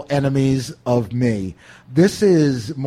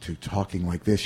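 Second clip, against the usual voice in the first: low, subdued and toned right down; Bill Murray's later style; 6 semitones lower, 6 dB quieter than usual. Shown as a demonstration. Host demonstrating an actor's new technique, low and subdued. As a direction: drop it to an understated murmur. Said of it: "Bill Murray's change in".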